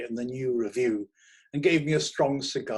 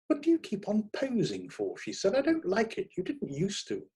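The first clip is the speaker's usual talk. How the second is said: high woman's voice, annoyed; Delia, irritated; 9 semitones higher than usual. A narrator's reading. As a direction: complain at him, voice raised high. Delia complains about his posing.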